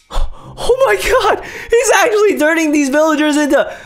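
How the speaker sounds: high-pitched gasping disbelief; gasp, then cry out in shock; horrified. Real, not acted. Horrified as villagers get infected.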